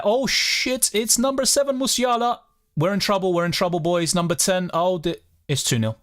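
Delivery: high-pitched shocked outburst, settling as it goes on; alarmed goal reaction. Real, not acted. Streamer watching Musiala score against Scotland, alarmed excitement. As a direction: react live as the goal goes in, pitch jumping then easing down.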